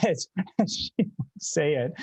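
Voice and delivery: amused, laughter breaking into speech; laughing. Real, not acted. Speaker shares grandmother's funny mispronunciation, laughing. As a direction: laugh through the words.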